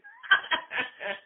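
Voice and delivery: loud, high laughing fit; high burst of laughter. Real, not acted. Reader cracking up at the scene, a high burst of laughter.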